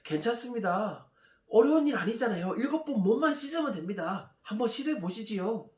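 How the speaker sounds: high-pitched, encouraging reassurance; coaxing subordinates. Acted. Subordinates persuading the general to wash, coaxing. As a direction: coax him to give it a try, voice lifted. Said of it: "부하들은 또 말려 세웁니다".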